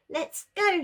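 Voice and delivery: high eager shout. A narrator's reading. Wilf shouting to the pack, eager and loud. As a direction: call out bursting to lead. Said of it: "shouted Wilf".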